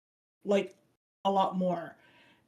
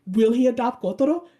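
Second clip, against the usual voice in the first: louder, hopeful fan question; a viewer begging for the answer; eager fan; 2 semitones higher, 6 dB louder, faster than usual. Retold or imitated. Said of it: "you're like".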